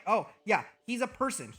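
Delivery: ordinary talk, nothing marked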